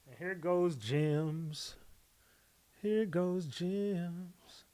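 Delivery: very slow, soft sing-song puppy reveal; slow soft coo. Real, not acted. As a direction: draw it out tenderly for the little dog. Presenting smallest puppy.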